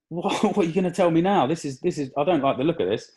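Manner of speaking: half-laugh through rising dread; mock-worried, chuckling; nervous laugh. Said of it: "And I was like".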